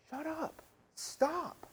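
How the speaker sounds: high-pitched command without raising the volume; acted stern command; commanding rebuke. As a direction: cut it off with high, separate orders. Jesus addressing the chaos head on.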